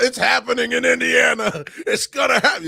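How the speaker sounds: a loud, high hammed-up hype voice; high mock announcement. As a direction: parody everyone's big promises. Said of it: "Everyone talk about".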